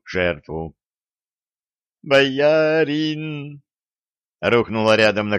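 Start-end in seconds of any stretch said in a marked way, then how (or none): 2.0-3.6 high, drawn-out wail; wailing lament. A narrator's reading. Girl falls to her knees by her boyar, wailing. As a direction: call out in grief for your master. Servant girl wails over fallen master.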